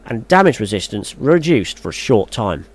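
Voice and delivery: steady, formal read-aloud of patch notes. Acted. Vlogger reading patch notes to his viewers, formal read. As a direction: read the blog out like an announcement.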